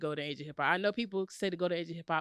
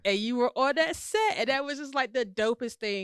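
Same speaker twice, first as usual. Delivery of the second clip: higher, louder, gushing with excitement; excited; 6 semitones higher, 6 dB louder than usual. Real, not acted. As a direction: rave about it, thrilled. Gushing about favorite music video.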